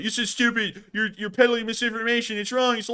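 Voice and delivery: high, mock-outraged accusations. Imitating commenters calling the video wrong, mocking. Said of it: "in a mocking tone".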